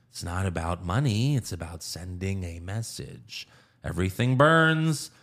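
measured menace that lifts on the final words; Joker. Retold or imitated. Villain explaining why he burns money, low then rising theatrically. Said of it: "then Joker's like".

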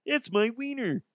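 high, goofy frog puppet voice; Kermit the Frog. Retold or imitated. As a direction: squeeze it up into a silly frog puppet. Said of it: "Sounds like Kermit the Frog".

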